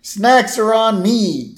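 high, loud and slow, a proud dad's toast; proud announcement. Retold or imitated. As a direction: announce it grandly to the crowd. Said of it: "And he says".

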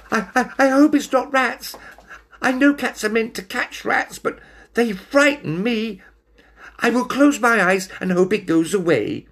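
high, stuttering frightened voice; nervous little cat; stammering scared cat. Acted.

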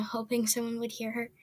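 ordinary delivery, nothing marked

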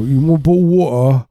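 high Cockney with swallowed Ts; mock British accent. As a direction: imitate a British friend dropping Ts. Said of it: "my friend, he would say".

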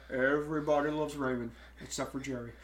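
lightly voiced impersonation of a TV actor; a hint of a sitcom actor impression; Brad Garrett. Host reading a chat comment, lightly impersonating an actor. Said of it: "Brad Garrett impersonation".

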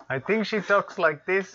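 attempted Egyptian accent. Player guesses how she talks, slow attempted Egyptian accent. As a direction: try an Egyptian accent, drawn out. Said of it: "What's an Egyptian accent?".

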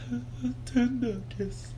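soft slow sultry radio voice. Pretend. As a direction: breathe it like a late-night DJ. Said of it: "The Quiet Storm".

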